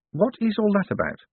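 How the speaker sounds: high-pitched bewildered question. A narrator's reading. Moist questions the patrician about the document, bewildered. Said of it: "Said Moyes, bewildered".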